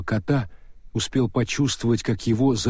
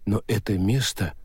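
plain reading voice

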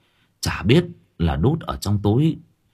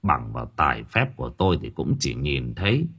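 low, softened voice of an elder; gentle old man; 5 semitones lower than usual. A narrator's reading. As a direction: counsel the family gently and gravely. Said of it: "Ông cụ Thân nhẹ nhàng bảo".

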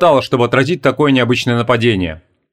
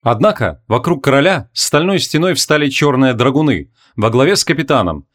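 usual speaking voice

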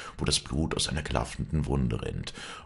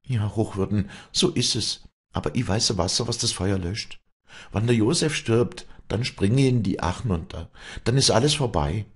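high, anguished pleading; Wally confessing her despair to the priest; 8 semitones higher, 4 dB louder than usual. A narrator's reading. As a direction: pour out her guilt. Wally threatens suicide.

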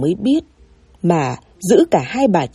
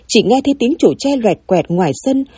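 ordinary delivery, nothing marked

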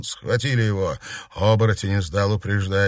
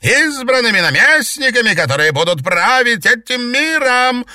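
very high, loud proclaiming; angry exalted; 12 semitones higher, 7 dB louder than usual. A narrator's reading. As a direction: rage about new power. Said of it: "разозлилась зеркальная ведьма".

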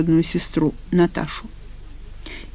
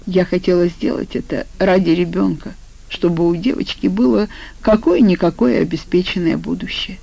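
usual speaking voice

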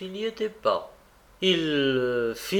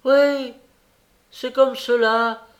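defiant; 9 semitones higher, 7 dB louder, slower than usual. A narrator's reading. Young woman's defiant confirmation.

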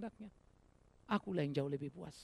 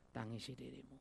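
soft quoted words; 6 semitones lower, 14 dB quieter than usual. Acted. Quoting Jesus softly.

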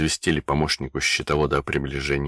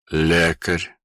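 strained. Acted. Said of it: "с трудом сказал Химиков".

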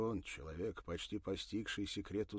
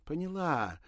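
fake happy; 9 semitones higher, 9 dB louder than usual. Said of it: "Ирина сделала счастливое лицо".

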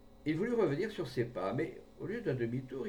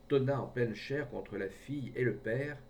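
usual speaking voice

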